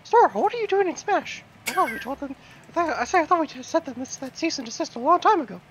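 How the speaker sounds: high falsetto cartoon mouse scolding; squeaky mascot taking someone to task; Mickey Mouse. Mascot scolds Sora about Smash, Mickey Mouse impression. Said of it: "I don't think he talked like Mickey Mouse".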